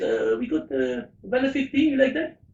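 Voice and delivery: put-on an amateur's French accent for the barman. Barman apologising to a skier, mock French accent. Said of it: "excuse my accents".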